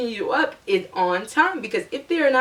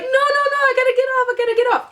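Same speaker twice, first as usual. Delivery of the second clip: high, frantic no no no; high frantic pleading to stop the bus; panicked plea; 3 dB louder, much faster than usual. Retold or imitated. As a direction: beg the driver to stop, voice up high. Panicked calling to bus driver.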